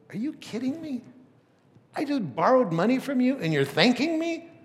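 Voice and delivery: high, loud disbelieving outburst; can't believe she's being thanked; imitating the stunned borrower; incredulous. Astonished borrower questions Joni.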